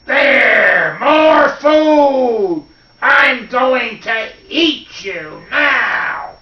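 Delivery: high, loud, drawn-out threat; ravenous clay boy. Acted. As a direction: bellow up at the prey, greedy and slow. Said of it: "looked up and he said".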